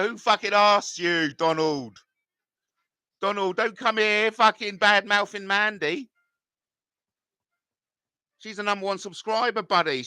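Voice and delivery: defending Mandy against the commenter Donald; irritated snap. Real, not acted. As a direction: put the commenter in his place.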